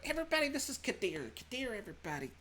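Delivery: high, mock-cheery introduction; sarcastic intro. Susanna stomping off, mock-cheery to the group. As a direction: brightly introduce while fuming. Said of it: "Susanna goes off and starts stomping".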